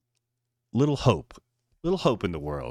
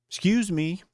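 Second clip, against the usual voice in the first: yelling; 10 semitones higher than usual. Acted. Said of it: "yelling at him".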